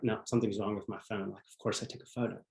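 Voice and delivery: quiet, fast, stunned denial; sinking disbelief. Retold or imitated. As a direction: mutter it in quiet disbelief as your heart sinks. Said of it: "And my heart just sank".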